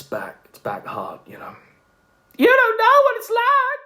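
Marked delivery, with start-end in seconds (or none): 2.4-3.8 very high, loud mocking squeal; high squeaky caricature voice; Brian voice. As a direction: pitch it way up like a whiny man. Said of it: "the Brian voice".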